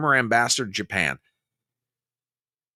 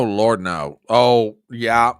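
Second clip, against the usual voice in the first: ordinary talk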